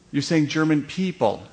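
ordinary talk

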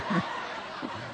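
soft breathless laugh; a quiet laugh through the breath; real laugh. Real, not acted. Reporter recalling the tearful ending, soft laugh.

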